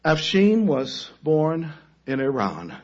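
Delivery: normal talking voice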